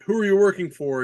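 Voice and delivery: tough interrogation. Retold or imitated. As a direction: snap the question out like an action hero. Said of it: "he's like".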